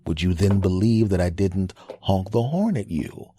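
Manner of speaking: ordinary delivery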